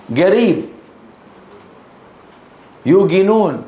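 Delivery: قريب as "gariib", يوقنون as "yuuginuun"; hard g for the ق in يوقنون; qaf as g. Shown as a demonstration. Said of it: "ينطق الصوت نفسه مقابل".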